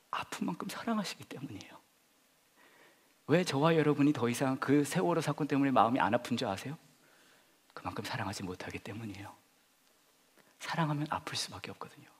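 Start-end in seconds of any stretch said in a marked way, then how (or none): 0.1-1.7 hushed, unvoiced, intimate; whispered sermon point. Preacher drawing listeners close, whispering. 7.7-12.1 hushed, unvoiced, intimate; whispered sermon point. Preacher drawing listeners close, whispering.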